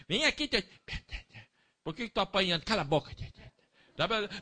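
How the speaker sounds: high, sharp, hammed-up scolding parent; impatient mock parent. Preacher showing the wrong way, high scolding parent voice. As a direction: act out a bad-tempered parent.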